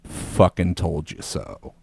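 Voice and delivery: low, slow smug dad voice; lightly voiced smug father; ghost dad voice. Ghost dad gloats at his son, low and slow. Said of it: "Force Ghost Dad out of nowhere goes".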